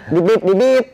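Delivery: mock censor beeps, rising high and loud; playful beep beep beep over the cut words; censor bleep.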